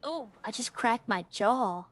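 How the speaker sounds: ordinary talk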